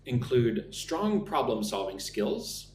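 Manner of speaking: slow, careful model answer; slow teacher demo answer; slow sample answer. Shown as a demonstration. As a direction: lay out the answer slowly for learners. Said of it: "I could say".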